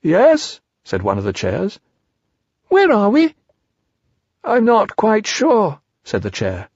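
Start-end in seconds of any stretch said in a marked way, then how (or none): 0.0-0.6 high, questioning storybook voice; pitched-up puzzled reply; talking chair. Acted. A chair answers Piglet. 2.7-3.4 very high, louder little voice; high squeaky question; Piglet bewildered. Acted. Piglet lost after the fall. 4.4-5.8 high, slow, puzzled voice; drawn-out storybook puzzlement; talking chair, unsure. Acted. The chair answers Piglet.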